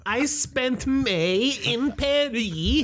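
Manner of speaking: slow, high put-on French-tinged accent; affected foreign accent. Student bragging about Paris, slow affected foreign accent. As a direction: stretch out a pretentious fake French accent.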